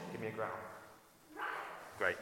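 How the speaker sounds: ordinary delivery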